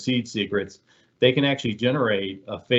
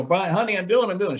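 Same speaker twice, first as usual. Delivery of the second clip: high, pleading, flustered wife; imitating a frazzled wife begging her husband; frantic housewife act; 9 semitones higher than usual. Hacker posing as wife pleads frantically with tech support. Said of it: "acting like she is this ... beaten housewife".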